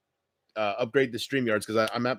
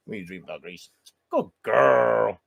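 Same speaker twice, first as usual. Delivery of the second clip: tender; 4 semitones lower than usual. Real, not acted. Soft talk to sleeping dog.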